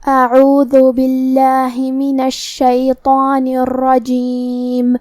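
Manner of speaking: slow, drawn-out tajweed chant; Quran recitation. Acted. Teacher opens the lesson with slow Quran recitation. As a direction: recite it slowly and melodically.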